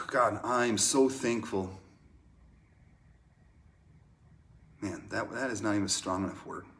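unhurried prayer with long pauses; hushed, spacious prayer, softening at the end; heartfelt prayer. Real, not acted. Leads a closing prayer.

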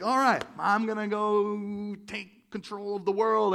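loud, drawn-out grand proclamation; mock resolve. People making New Year's resolutions, loud mock resolve. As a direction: declare it slowly, hammed-up and over-confident.

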